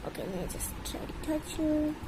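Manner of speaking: soft whispered aside. Real, not acted. Owner whispering before touching her bird, hushed. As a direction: hush so the bird stays calm.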